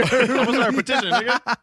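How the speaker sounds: high, loud, laughing while talking; laughing through the words. Real, not acted. Laughing mock petition.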